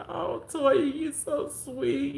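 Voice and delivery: high, drawn-out mock-tearful gratitude; imitating tearful woman. Crying mama thanks Toya for remembering, mock tearful. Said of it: "started crying and she was like".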